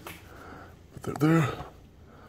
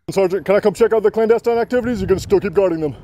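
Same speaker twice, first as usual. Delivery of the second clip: much higher, louder Long Island auditor put-on; 10 semitones higher, 6 dB louder than usual. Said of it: "I've heard Sean Reyes say that exact sentence in that exact voice".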